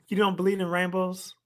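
normal talking voice

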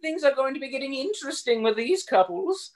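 high, gushing society-lady voice; gossipy hotel lady. Retold or imitated. Friend voices the hotel lady gossiping to Poirot. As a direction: relish the juicy gossip. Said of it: "She didn't talk like that".

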